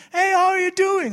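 very high, loud hearty greeting; overdone cheerful pastor hello; imitating pastor Gary. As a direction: greet him like a best buddy. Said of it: "hij zegt van".

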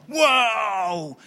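big, loud, high wow; exultant exclamation. Acted. Preacher declaring victory in his life, exultant cry. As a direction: let the word burst out and swell.

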